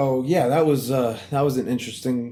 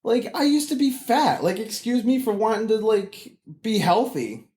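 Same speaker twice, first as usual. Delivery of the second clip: indignant; 8 semitones higher than usual. Retold or imitated. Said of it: "And I'm just like".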